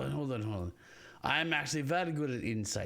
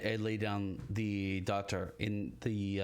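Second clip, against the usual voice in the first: ordinary talk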